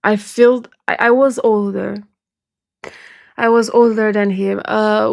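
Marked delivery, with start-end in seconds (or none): none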